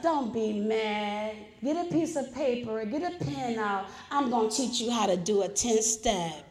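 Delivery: calm a riled-up newcomer down softly; gentle sponsor. Retold or imitated. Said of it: "that's just how she was talking to me".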